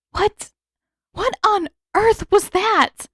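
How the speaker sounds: high, slow, breathless disbelief; breathless shock. Acted. Katara after the monster leaves, breathless and high. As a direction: gasp it out, shaken. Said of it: "She sounded breathless".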